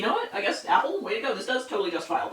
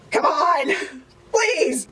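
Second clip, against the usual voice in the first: high loud plea; pleading yell; 8 semitones higher, 6 dB louder, much slower than usual. Real, not acted. Calling to the birds with a high loud plea.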